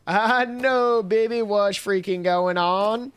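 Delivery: mock excited holler; hammed-up outburst. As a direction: play it up big and high. Continues over-the-top put-on bit.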